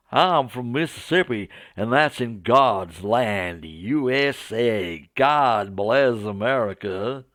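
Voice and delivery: slow, drawn-out Southern preacher's drawl; hammed-up Southern American; Southern American accent. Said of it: "my poor attempt at a Southern American accent".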